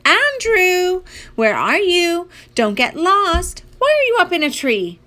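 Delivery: put-on high, singing-out call for a child; mom calling out. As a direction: call across a distance to a lost kid. Mom calls for her hidden son.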